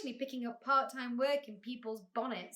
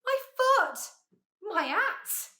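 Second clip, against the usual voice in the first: high, loud indignant yelps; outraged exclamations; shout; 10 semitones higher, 8 dB louder, slower than usual. A narrator's reading. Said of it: "shouted the bug".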